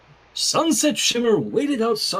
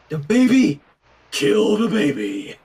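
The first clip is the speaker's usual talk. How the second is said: mock villain cry; 5 dB louder than usual.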